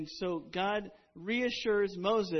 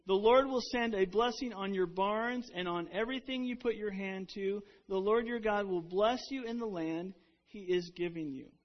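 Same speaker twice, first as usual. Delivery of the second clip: louder, declaiming Bible verse; scripture reading; 3 semitones higher, 5 dB louder than usual. Acted. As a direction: proclaim the verse to the congregation. Reads scripture aloud.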